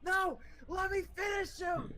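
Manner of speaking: very high mock shout; frenzied refusal. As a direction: act out a wild demand to keep fighting. Acts out refusal to stop fighting.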